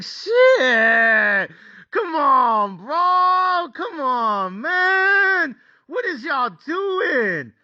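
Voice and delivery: high, drawn-out mock wail; anguished pleading. Bettor reliving the lead slipping to twenty-nine twenty-one, anguished wail. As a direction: stretch each plea into a high howl. Acts out panic as lead shrinks.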